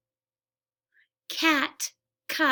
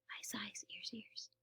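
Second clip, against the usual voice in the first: whispering; 3 semitones lower, 24 dB quieter than usual. Acted. Whispered sound-game chant.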